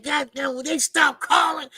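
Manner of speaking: mock furious complaint over the calls; retold frustrated outburst. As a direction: blow up at the interruptions. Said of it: "I was mad".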